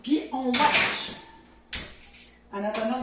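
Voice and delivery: ordinary talk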